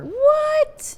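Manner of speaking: real disbelief bursting out; shocked what. Real, not acted. Host hears the scammer said I love you, stunned.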